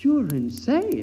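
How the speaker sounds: high, slow, horrified gasp of disbelief; frightened. Acted. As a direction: recoil from the puppet in terror. Terrified man confronting his puppet.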